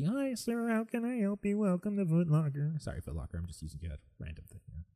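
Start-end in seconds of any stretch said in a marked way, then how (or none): 0.0-2.7 high, sugary customer-service voice; nice store clerk. Retold or imitated. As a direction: greet a customer with exaggerated niceness. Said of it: "you have to be nice".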